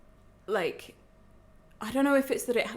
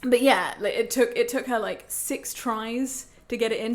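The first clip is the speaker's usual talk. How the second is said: ordinary talk, nothing marked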